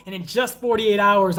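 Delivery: usual speaking voice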